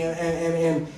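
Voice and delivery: ordinary delivery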